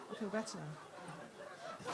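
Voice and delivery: low, very soft, drawn-out; soothed and hushed. Acted. Comic settling after her deli outburst, hushed relief. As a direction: sink into a slow, quiet calm.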